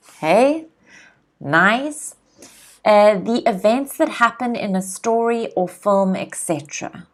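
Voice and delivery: ordinary talk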